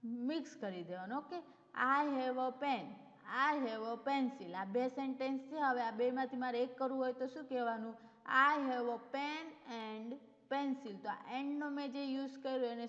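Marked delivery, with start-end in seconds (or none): 1.8-4.5 slow dictation. Shown as a demonstration. Teacher dictates example sentences. 8.3-11.0 slow dictation. Shown as a demonstration. Teacher dictates joined example sentence.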